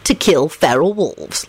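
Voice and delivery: slow, self-satisfied reply; mildly smug, unhurried. A narrator's reading. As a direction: savour the answer slowly. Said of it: "replied in a satisfied voice".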